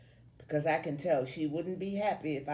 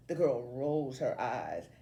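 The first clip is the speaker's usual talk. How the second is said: plain reading voice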